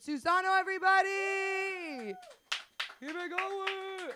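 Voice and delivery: loud, high, stretched host call; emcee hype. Real, not acted. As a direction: hype the crowd for the next act. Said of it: "put your hands together".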